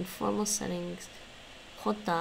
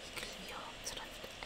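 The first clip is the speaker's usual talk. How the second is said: whispering; 18 dB quieter than usual. Real, not acted. Whispered repeat of app phrase.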